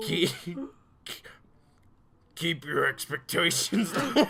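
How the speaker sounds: laughing through a gruff biker voice; gravelly biker drawl. Acted. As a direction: try a rough biker growl, cracking up. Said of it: "really bad, like, biker impression".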